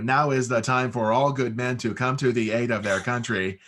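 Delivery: the wrong way, read aloud and wooden; stiff reedy read. Shown as a demonstration. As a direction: announce it line by line like reading from a page. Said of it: "is not the, how you get cast".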